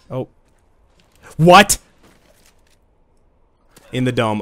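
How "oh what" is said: surprised yelp. Real, not acted. Sudden surprise in game.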